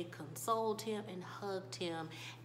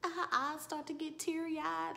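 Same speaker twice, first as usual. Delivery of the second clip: ordinary talk, nothing marked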